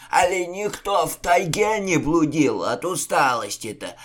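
gruff bluster hiding fear. A narrator's reading. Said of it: "Тон атамана скрывал затаившийся страх".

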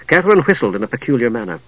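plain reading voice

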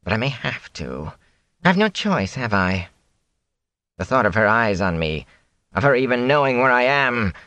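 put-on a frightened, ragged old peddler; twitchy peddler. A narrator's reading. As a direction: voice a nervy, boastful peddler. Peddler fearful.

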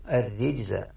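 الرجز as "ar-rijza", i after the r; kasra on the ra. Shown as a demonstration. Teacher repeating the readers' form, الرجز said "ar-rijza". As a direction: pronounce الرجز with short i on its ra. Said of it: "بكسر الراء".

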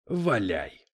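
mildly lordly permission; a touch of indulgent generosity; magnanimous. A narrator's reading. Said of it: "великодушно позволил ему Дэвид".

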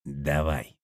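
ordinary delivery, nothing marked

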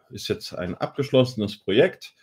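normal talking voice

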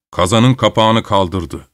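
ordinary delivery, nothing marked

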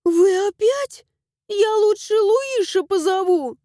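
high and loud, resolute; firm, alarmed cry. A narrator's reading. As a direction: declare it firmly in a high voice. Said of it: "заявила она решительно".

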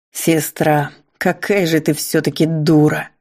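ordinary delivery, nothing marked

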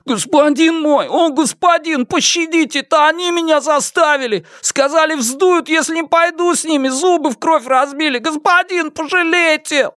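high-pitched, desperate begging voice; frantic pleading. A narrator's reading. Beaten man begging his captor for mercy, frantic high-pitched pleading. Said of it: "скороговоркой умоляя".

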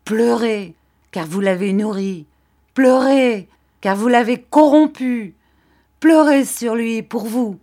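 crescendo fort et aigu; invective furieuse. A narrator's reading. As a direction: marteler chaque « Pleurez ». Rosa maudit la mère. (invective pleine de rage)

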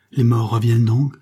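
loud, frightened question; horrified disbelief. A narrator's reading. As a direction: cry out as if seeing a ghost. Terrified woman facing a supposed dead man. (cri d'épouvante incrédule)

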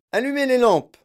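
high, loud command; shouted order. A narrator's reading. Wonka ordering light in the tunnel, shouting. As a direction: call it out to the whole crew. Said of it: "cria Monsieur Wonka".

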